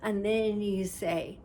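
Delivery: ordinary delivery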